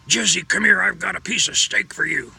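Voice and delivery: loud, harsh order to the dog. Shown as a demonstration. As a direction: bark sweet words harshly at the dog. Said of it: "dogs don't understand words so much as they do our tone".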